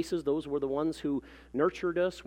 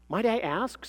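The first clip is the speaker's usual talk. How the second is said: higher, politely curious clerk; new interest; 7 semitones higher than usual. A narrator's reading. Clerk questions the self-styled research associate, with new interest. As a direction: ask as if newly intrigued. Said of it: "with new interest".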